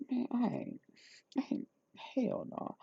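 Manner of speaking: muttered aside. Real, not acted. Vlogger muttering disbelief to herself, whispered. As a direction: drop to a whisper.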